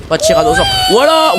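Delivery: high, shrieking cry of protest; panicked shout. Real, not acted. Begging the game not to kill his T-Rex, shrieking high. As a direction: scream to save the dinosaur.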